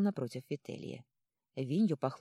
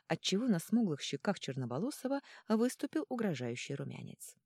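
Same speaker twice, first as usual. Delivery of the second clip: ordinary delivery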